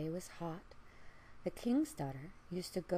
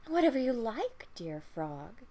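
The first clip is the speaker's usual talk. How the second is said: louder, higher eager offer; eager princess; 3 semitones higher, 6 dB louder than usual. Acted. Princess eagerly offering the frog anything. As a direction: blurt it out, desperate to get the ball back.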